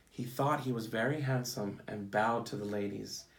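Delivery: storybook read-aloud for a class; picture-book storytime voice; reading to children. Acted.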